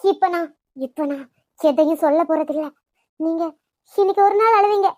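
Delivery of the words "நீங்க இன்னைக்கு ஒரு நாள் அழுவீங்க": louder, pushing the words out hard; a loud, exasperated rebuke; raised scolding voice. Acted. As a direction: snap at them to stop crying. Scolding family in distress.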